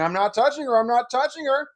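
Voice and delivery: put-on boy needles his stepmother's husband; taunting innocence. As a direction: claim innocence while needling her.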